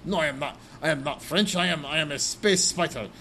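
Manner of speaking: cartoonish French accent; ridiculous French accent. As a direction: protest in a hammed-up French accent. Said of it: "the most ridiculous French accent".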